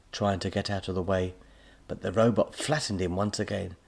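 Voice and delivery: ordinary delivery, nothing marked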